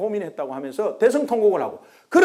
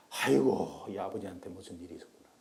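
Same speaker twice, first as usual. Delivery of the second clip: ordinary talk, nothing marked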